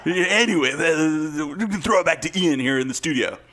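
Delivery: put-on radio host sign-off; old-timey radio voice. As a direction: toss it back to the studio like an old broadcaster. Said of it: "old radio timey voice".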